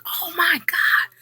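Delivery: slow high whispered horror; slow whispered oh my God; horrified whisper. Retold or imitated. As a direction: breathe it out in shock.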